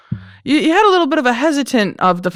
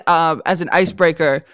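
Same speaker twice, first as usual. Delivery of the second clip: ordinary talk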